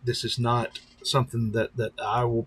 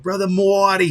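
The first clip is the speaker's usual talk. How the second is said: loud, high mock Boston greeting; imitating a Boston accent; 8 semitones higher, 10 dB louder than usual. Viewer greeting the pastor in a mock Boston accent. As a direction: call out the name in a put-on Boston accent. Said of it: "that cool of an accent".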